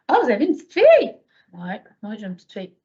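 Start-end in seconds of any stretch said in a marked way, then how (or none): 0.1-1.2 delighted surprise. Retold or imitated. Delighted reply to cashier.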